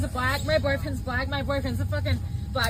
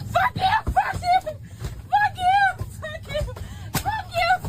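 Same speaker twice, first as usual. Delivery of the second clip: real screaming; slower than usual. Real, not acted. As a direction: scream it at them, raw. Restrained woman screaming at crew.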